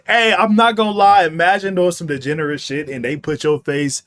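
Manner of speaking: normal talking voice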